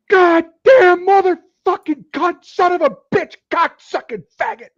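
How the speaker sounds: high, loud enraged screaming; raging gamer yelling curses at the screen; screaming rage. Retold or imitated. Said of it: "and he'd be screaming".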